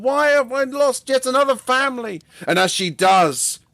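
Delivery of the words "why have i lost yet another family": high, frightened cry; scared. Acted. Tulia flees in fear, asking why, high-pitched. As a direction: plead it out in fear and confusion. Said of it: "She's scared".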